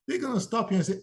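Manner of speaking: usual speaking voice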